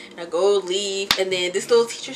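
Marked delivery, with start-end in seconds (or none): none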